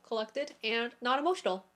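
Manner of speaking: usual speaking voice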